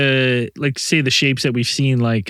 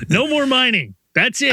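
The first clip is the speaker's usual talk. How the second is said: mock townsfolk; 11 semitones higher than usual. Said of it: "they're like".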